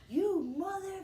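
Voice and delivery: high, loud imitation of an enraged mother; furious mom outburst. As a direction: screech it as mom losing it. Retold fight, mom explodes at Papa.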